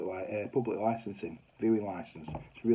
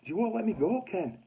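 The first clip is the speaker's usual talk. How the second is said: much higher-pitched, exasperated repeat; pleading insistence; 10 semitones higher, 3 dB louder than usual. Retold or imitated. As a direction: insist to a boss who won't believe it. Repeats it to disbelieving boss.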